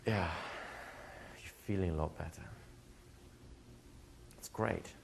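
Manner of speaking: soft, settled reassurance with long pauses; imitating a hushed, soothing coach. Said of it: "takes it, like, deeper and goes".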